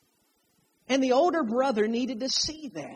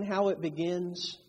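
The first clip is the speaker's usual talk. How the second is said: ordinary talk, nothing marked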